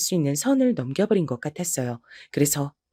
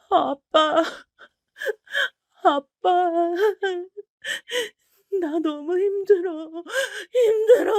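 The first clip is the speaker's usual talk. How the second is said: high sobbing plea to dad; crying; 12 semitones higher, slower than usual. Acted. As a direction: choke it out through tears, slow. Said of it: "엉엉 울면서 말을 해버렸답니다". (울먹이며 흐느끼는 목소리)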